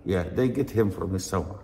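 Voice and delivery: normal talking voice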